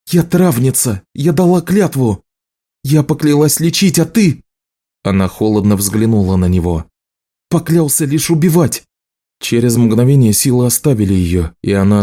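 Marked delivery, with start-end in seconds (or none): 0.1-4.4 high-pitched, heated protest; impassioned indignation. A narrator's reading. Healer invokes her oath at the killer, high indignant voice. 7.5-8.8 high-pitched angry charge; bitter accusation. A narrator's reading. Healer accuses the marked man, high angry voice.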